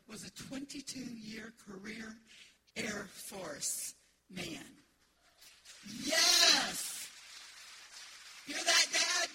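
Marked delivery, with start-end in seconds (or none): 6.0-9.3 loud, higher cheer up to Dad; joyful shout. Real, not acted. Calls out to late father.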